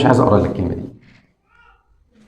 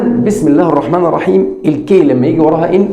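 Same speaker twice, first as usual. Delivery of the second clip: usual speaking voice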